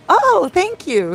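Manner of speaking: high, drawn-out "oh" of delight; flattered thanks. Retold or imitated. YouTuber thinking a fan means her, flattered high-pitched thanks. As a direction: gush it high and slow, playfully acted.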